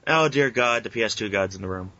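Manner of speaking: ordinary talk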